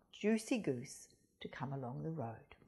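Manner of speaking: ordinary delivery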